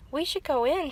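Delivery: mild shout. A narrator's reading. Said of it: "shouted Franklin".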